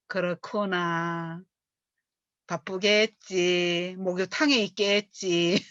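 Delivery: very slow, easygoing shrug-off; calm understanding. Shown as a demonstration. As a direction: let it go slowly and gently. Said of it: "이렇게". (느긋하고 너그럽게 넘기는 말투)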